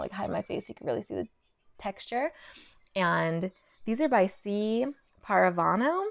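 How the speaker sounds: ordinary delivery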